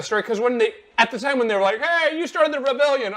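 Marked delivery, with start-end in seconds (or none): 1.8-3.1 high, hammed-up announcer praise; mock game voice. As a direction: sarcastically imitate the game's praise. Quoting the game's flattering line.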